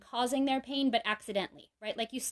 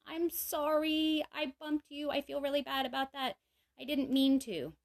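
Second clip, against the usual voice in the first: sincere apology; 5 semitones higher than usual. Shown as a demonstration.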